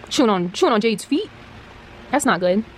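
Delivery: normal talking voice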